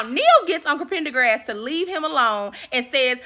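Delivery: ordinary talk, nothing marked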